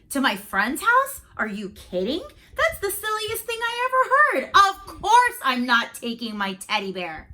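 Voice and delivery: loud, high, scornful brush-off; scoffing boy. Acted. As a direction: laugh the idea off as silly. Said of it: "I said".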